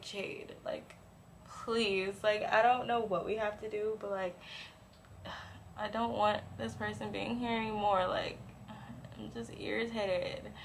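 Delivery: soft, weary retold appeal; hushed pleading. Begging best friend to get rid of him, hushed. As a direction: plead quietly, worn down by irritation.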